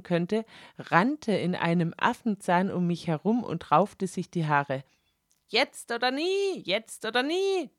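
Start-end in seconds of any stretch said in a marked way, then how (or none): 5.5-7.7 high, urgent goading cry; Fräulein Zwang yelling. Acted. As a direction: push her on, frantic and high. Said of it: "brüllte sie". (Fräulein Zwang treibt sie hoch und drängend an)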